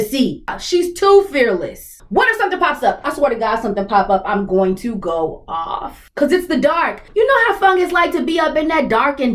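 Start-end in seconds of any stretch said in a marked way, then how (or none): none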